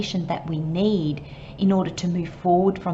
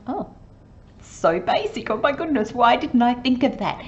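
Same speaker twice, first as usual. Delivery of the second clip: higher, light self-mocking surprise; mock realisation; 5 semitones higher than usual. Person suddenly seeing a simple fix, light mock surprise. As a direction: act like the answer was obvious all along.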